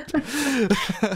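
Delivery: high delighted laugh. Real, not acted. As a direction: burst out laughing. Laughs at headline.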